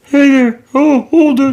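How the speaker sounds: high cartoon child imitation; Cleveland Junior. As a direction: pitch it up like a cartoon boy. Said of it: "It sounds like that".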